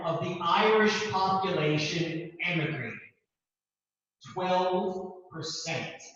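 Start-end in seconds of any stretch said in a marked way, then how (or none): 4.3-6.0 "Twelve percent" drawn out slowly, word by word; emphatic repeat. Real, not acted. Lecturer repeating a startling emigration figure to the class, slow and emphatic.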